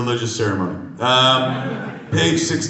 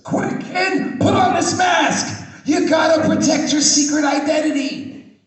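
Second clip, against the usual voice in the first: much higher, loud comic sidekick; high excited sidekick; 6 dB louder than usual. Acted. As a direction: shout it like an eager cartoon hero. Sidekick voiced in comic script read.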